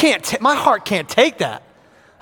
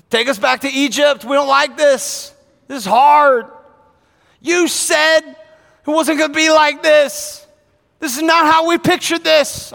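whiny, higher complaint; a mild mock whine of complaint; complaining Israelites; 6 semitones higher, 4 dB louder than usual. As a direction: moan like grumbling people.

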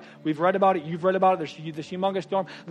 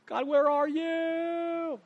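slow, high, drawn-out plea to God; pleading prayer; 13 semitones higher, 5 dB louder, much slower than usual. Retold or imitated. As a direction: stretch it out like a lost soul.

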